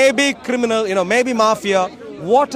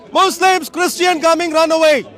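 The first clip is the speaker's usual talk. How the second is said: raised, higher mock shout of alarm; hammed-up cry telling the crowd to flee; mock warning call; 5 semitones higher, 4 dB louder than usual. Preacher mocking Muslims' warning cry at Speaker's Corner, raised mock shout. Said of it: "called to run away".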